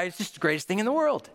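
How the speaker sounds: usual speaking voice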